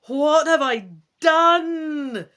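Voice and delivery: very slow, loud horrified cry; panicked shout. Acted. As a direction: shout it in dismay at the disaster. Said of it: "you shout".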